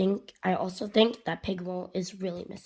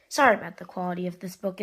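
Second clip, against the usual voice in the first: plain reading voice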